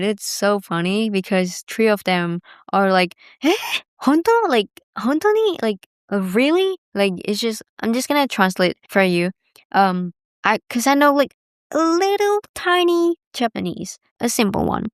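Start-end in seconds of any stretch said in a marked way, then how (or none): 3.4-6.8 high, amazed "Honto ni?"; surprised Japanese. Retold or imitated. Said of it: "they were saying that in Japanese". 10.7-14.0 high playful aside. Real, not acted.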